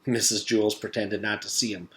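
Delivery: ordinary delivery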